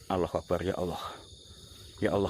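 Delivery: low, soft murmured invocation; hushed prayer. Real, not acted. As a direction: pray under your breath after a scare. Murmured prayer after a fright. (berdoa lirih)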